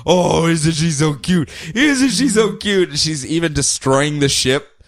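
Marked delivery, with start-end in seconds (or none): 0.0-2.9 Sinestro. Retold or imitated. Villain taunting her as they fight, mocking. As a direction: coo at her like a silly little child. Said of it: "Sinestro making fun of her".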